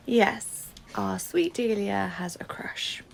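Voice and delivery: ordinary talk, nothing marked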